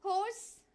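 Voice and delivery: horse with rounded long o, rising; model pronunciation. Shown as a demonstration. Teacher having students repeat horse. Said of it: "so try to say that".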